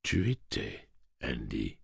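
soft, low and slow; gentle. Acted. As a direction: correct him tenderly. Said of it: "corrigea Eddie d'une voix douce".

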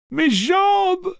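very high, loud joyous yell; joyful scream. Acted. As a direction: scream it with wild joy. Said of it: "hurlait-elle à plein poumon".

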